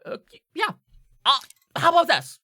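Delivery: high-pitched, loud character voice; squeaked-up craftsman striking a deal; haggling tinkerer. Acted. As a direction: make the offer pitched right up. Tinkerer makes a hurried offer.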